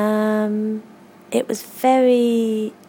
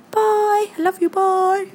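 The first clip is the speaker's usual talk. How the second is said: sweet goodbye; 9 semitones higher than usual. Real, not acted.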